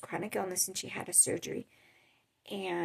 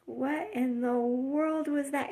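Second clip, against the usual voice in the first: higher, slow, drawn-out retold astonishment; baffled disbelief; 6 semitones higher, 4 dB louder, slower than usual. Onlooker after she stormed off, baffled. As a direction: act out stunned bafflement, stretching the words.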